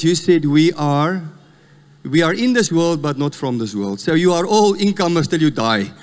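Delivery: usual speaking voice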